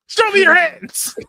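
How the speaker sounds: slow, loud, high barked order; cop shout. Pretend. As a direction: bellow it like a cop. Cop shout as weapon line.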